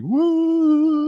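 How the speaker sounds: long, high sliding woo; imitated theme music. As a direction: hoot the old theme in a drawn-out high note. Imitating old seasons' theme music.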